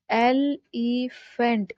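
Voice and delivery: "एलिफेंट" slowly in three separate beats; syllable by syllable. Shown as a demonstration. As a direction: break "एलिफेंट" into three syllables, as if clapping each. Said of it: "साथ में clap कर सकते हैं". ("एलिफेंट" को तीन हिस्सों में धीरे-धीरे)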